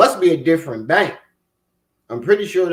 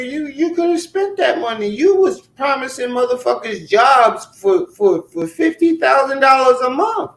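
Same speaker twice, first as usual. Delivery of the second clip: higher and rising to a shout about the fifty thousand; heated accusation; 8 semitones higher than usual. Real, not acted. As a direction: build from pitched-up disbelief to a yell.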